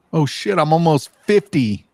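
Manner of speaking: ordinary talk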